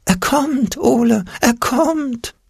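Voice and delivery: very high, slow panicked warning; shrill fearful alarm, drawn out; Angstpeter crying out. A narrator's reading. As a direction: squeal it like a terrified imp. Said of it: "der Angstpeter schrie auf".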